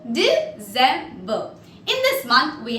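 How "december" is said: DECEMBER split as De, cem, ber; syllable-by-syllable demo. Shown as a demonstration. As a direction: break "December" into three separate syllables. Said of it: "this is how we pronounce December".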